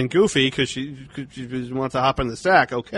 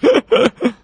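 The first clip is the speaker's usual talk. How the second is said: high shrieking laugh; 8 dB louder than usual. Real, not acted. Laughing at own joke.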